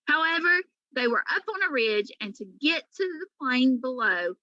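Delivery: ordinary delivery, nothing marked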